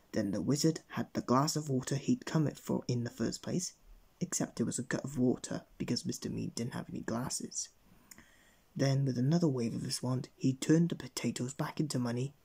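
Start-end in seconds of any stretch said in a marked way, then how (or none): none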